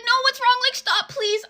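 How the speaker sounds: high, whiny mock pleading; hammed-up desperate boyfriend; imitating pleading boyfriend. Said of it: "And he's like".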